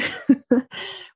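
short, breathy chuckle; light laugh. Real, not acted. Podcaster amused by their love of sparkles, light laugh. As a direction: chuckle briefly at yourself.

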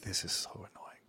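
whispered exasperated aside; dad's annoyed aside. Retold or imitated. Fed-up dad whispering his annoyance to himself. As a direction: sigh it out under your breath. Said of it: "And the dad's like".

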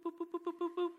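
string of high, light "boop" noises; high scampering boops. Retold or imitated. Imitating Sam dashing to the bathroom, high boops. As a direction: imitate quick little feet in boops.

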